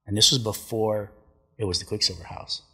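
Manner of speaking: usual speaking voice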